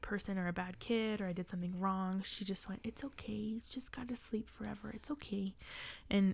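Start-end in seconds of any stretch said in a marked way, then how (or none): 2.8-5.5 soft, hushed motherly comfort; gentle reassurance. Retold or imitated. Mom explaining the bird's death to her child, gently reassuring. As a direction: imitate a mother quietly soothing her child.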